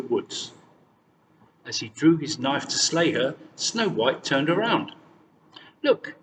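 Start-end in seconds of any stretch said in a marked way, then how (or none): 5.8-6.2 put-on bright, much higher young girl; high girlish voice. A narrator's reading.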